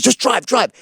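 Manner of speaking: high-pitched hurried command; retold urgency. Retold passenger fleeing drug buy.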